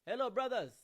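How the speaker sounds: put-on high, loud African-accented greeting; mock friendly holler in a put-on accent; imitated calling out. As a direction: hail a group across the way.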